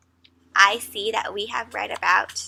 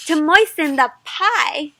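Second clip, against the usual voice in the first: ordinary talk, nothing marked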